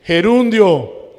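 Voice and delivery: loud, slow, drawn-out "Gerundio"; teacher loudly confirming the answer. Real, not acted. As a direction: call out the answer to the class. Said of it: "¿Cómo se llama esa forma verbal?".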